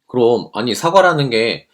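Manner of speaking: normal talking voice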